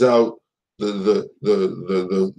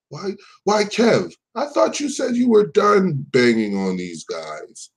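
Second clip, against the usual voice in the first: mocking; 8 semitones higher than usual. Real, not acted. Mocking rival again.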